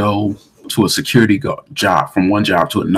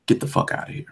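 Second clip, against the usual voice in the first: low-key, much softer dismissal; imitated cop letting go; 9 dB quieter, faster than usual. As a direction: send them off under your voice. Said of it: "He said it like that".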